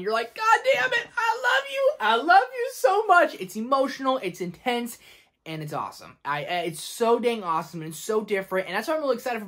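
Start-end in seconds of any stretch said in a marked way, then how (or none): 0.3-3.3 high, cracking squeal of adoration; overwhelmed fan squealing his love; mock-swooning high gush. Fan gushing at emotional scene.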